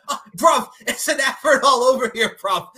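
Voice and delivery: high, loud mock London "bruv"; imitating Will's London accent. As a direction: ham up a London lad. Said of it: "He's like".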